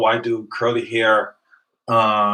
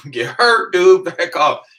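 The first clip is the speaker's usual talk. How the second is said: mock tough warning; 10 semitones higher, 6 dB louder, slower than usual. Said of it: "I'm like".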